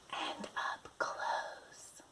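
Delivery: slow whisper, right in the ear; close whisper. Acted. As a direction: whisper it as if right beside them. Acting out close sound.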